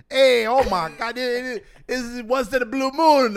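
put-on high, slow comic Mexican accent; mock Mexican-accented driver; attempted Mexican accent. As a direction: imitate a Mexican Uber driver's accent, loud and drawn out. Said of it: "what's the Mexican accent?".

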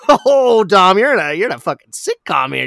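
high, loud mocking outburst; high loud mock exasperation; mock exasperated. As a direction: tease him loudly for the one-liners. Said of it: "And it's like".

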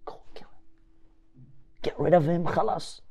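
hushed whisper urging a killing. Retold or imitated. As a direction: breathe it secretly into his ear. Umar urging murder in secret.

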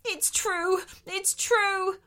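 high, hammed-up wail. Shark admitting he has no teeth, wailing. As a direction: wail the confession in shame. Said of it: "wailed the shark".

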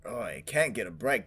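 ordinary delivery

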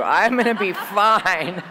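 laughing through the words, drawn out. Real, not acted. Joking to the women about overeating, laughing. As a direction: let the laugh break into it.

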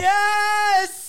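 loud, high yes; excited cheer. Real, not acted. Cheering the sale.

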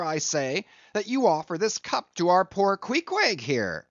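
high-pitched, mounting indignant tirade; indignant outburst. A narrator's reading. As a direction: fire off scornful, incredulous questions. Stubb's indignant tirade at the steward.

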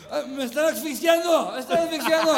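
loud, high hammed-up panic; mock panicked drunk. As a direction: cry out as if choking, hammed up. Acting the drunk uncle choking.

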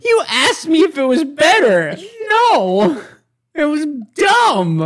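very high, loud protest; exasperated shout. Real, not acted. Host rejecting cohost's joke, exasperated shouting. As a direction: yell it back, indignant. Mock quarrel over a joke.

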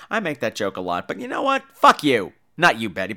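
ordinary talk

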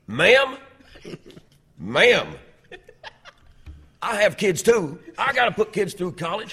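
loud, high-pitched mock outrage; going off on someone. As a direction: yell indignantly, as in a comic tirade. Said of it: "he just went off on her".

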